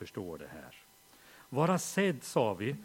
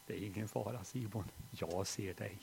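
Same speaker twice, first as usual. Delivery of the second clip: soft reassurance; 5 semitones lower, 8 dB quieter than usual. Retold or imitated. Father silently comforting lost son.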